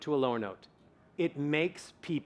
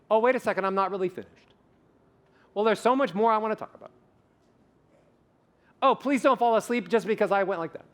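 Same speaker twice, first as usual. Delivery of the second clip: energetic; 5 semitones higher, faster than usual. Shown as a demonstration. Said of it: "when you go down".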